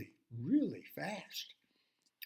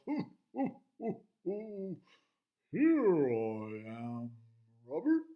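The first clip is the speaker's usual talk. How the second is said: gentle turtle hide-and-seek call; playful hooting call; 6 dB louder, slower than usual. Acted. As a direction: tease your friend from your hiding spot. Turtle calls from hiding.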